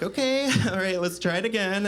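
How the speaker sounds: high, chirpy fake-patient bot voice; mock chatbot voice. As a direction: play a cheerful AI resetting the chat. Imitates chatbot girlfriend resetting.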